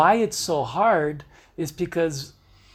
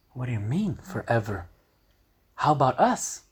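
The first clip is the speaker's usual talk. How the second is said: much lower, softer, stunned; hurt disbelief; 5 semitones lower, 3 dB quieter than usual. Acted. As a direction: ask it like the floor dropped. Breakup quarrel, his reply.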